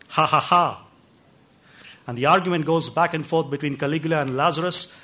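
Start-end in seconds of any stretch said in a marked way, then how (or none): 0.1-0.8 mock laughter. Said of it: "Lazarus' reaction".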